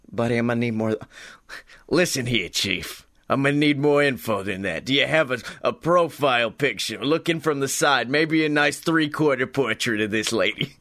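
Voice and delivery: low, gruff detective voice; gruff private eye. Retold or imitated. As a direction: lay on the hard-boiled private eye. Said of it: "Scotty Moore, private fucking investigator".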